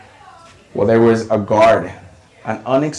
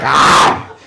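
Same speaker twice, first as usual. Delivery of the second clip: loud, raspy pouncing yell; mock hyena cry; 4 dB louder than usual. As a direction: imitate a hyena going on the attack. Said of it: "A hyena. She's like".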